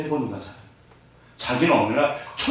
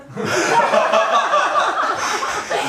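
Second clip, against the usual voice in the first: a high burst of laughter; real giggle, pitched way up. Real, not acted. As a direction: laugh at your own cheeky joke. Laughing at own joke.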